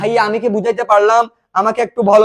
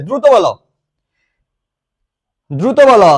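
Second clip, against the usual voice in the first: loud, insistent call to answer; urgent prompting; 6 dB louder than usual. Real, not acted. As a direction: chivvy the students along loudly. Teacher urges class to answer quickly.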